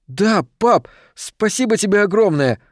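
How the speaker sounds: put-on high-pitched happy child's thanks; excited kid thanking dad, voice lifted high; delighted, grateful boy. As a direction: gush like a thrilled little son. Boy thanks father for gifts.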